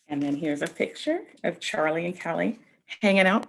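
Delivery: ordinary talk, nothing marked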